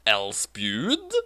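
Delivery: normal talking voice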